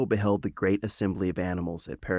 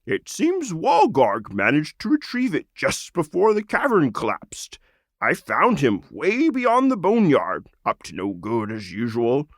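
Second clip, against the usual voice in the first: lightly voiced old frog, higher; a friendly frog returning a treasure; Krogrog the frog; 7 semitones higher, 4 dB louder than usual. Frog returns the sword to the lizard, lightly voiced.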